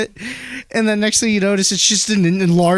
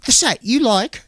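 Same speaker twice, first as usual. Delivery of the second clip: mock British, pitched up; lightly put-on British voice; British accent; 5 semitones higher than usual. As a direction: imitate a British singer checking in. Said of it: "I just find the British accent funny".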